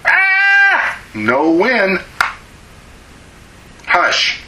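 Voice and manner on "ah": imitated child's wail. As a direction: squeal like a child faking a tantrum.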